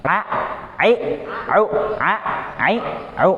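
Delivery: "a, ai, au" kept in the throat, nose closed; correct ain from the throat. Shown as a demonstration. Teacher shows ain sounds the same with nose held. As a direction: say "a, ai, au" without any air in the nose. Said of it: "sama aja kan".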